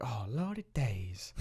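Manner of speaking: slow Southern drawl, softly; slow, quiet take on a Southern twang; Southern accent. Retold or imitated. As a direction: imitate a coworker's drawn-out Southern accent. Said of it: "like, a southern accent".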